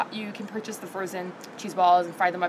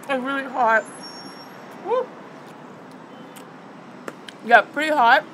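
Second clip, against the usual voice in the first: chewing while talking; reacting to spicy food; mouth full; 3 semitones higher, 3 dB quieter than usual. Real, not acted. Seen 2: Cheeks puffed, chewing throughout; hand over mouth only at -4.0.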